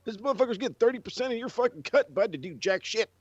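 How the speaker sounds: high-pitched indignant protest; outraged rant. Retold or imitated. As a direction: argue it in high, exasperated disbelief. Acted outraged reply about the contract.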